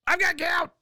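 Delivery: wailing complaint. Retold or imitated. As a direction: squeal it out like a hurt kid.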